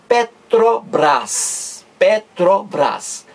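Petrobras very slowly, broken pe-tro-BRAS; sounded out by syllables. Shown as a demonstration. Teacher showing the whole word is pronounced, syllable by syllable. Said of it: "eu estou pronunciando tudo".

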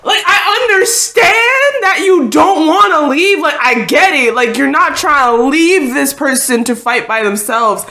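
loud, high, insistent argument; exasperated pleading. Real, not acted. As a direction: plead loudly with someone who won't listen. Pleading angrily with the character.

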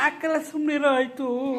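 high, loud, drawn-out hushing; real exasperated shout, stretched out; scolding. Real, not acted. Scolding a child to hush.

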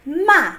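Ma falling; fourth tone ma. Shown as a demonstration. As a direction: drop Ma sharply. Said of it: "So ready?".

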